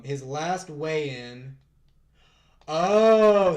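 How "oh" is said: loud, high surprised Oh. Real, not acted. Streamer startled at a fighter's weigh-in.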